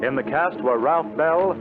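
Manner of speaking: ordinary delivery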